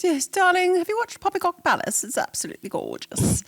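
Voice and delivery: high, fast fancy mother voice; posh mom voice. Retold or imitated. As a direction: gush like a refined mom. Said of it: "sounds like something your mom would say".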